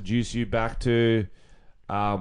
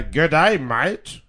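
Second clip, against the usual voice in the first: weirdo politician; 4 semitones higher, much slower than usual. Acted. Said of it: "picture a politician though? Like the nerdy".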